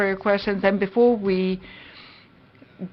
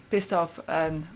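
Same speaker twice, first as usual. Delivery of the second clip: "pissed off" said again, softer and embarrassed; sheepish repeat; 3 semitones lower, 7 dB quieter than usual. Real, not acted. Speaker excusing his rude word, repeating it softer. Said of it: "uh, sorry".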